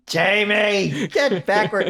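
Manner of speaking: high, loud, drawn-out mock summons; calling out. Viewer calls Jamie back to where they belong, mock pleading call. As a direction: call the stray character back.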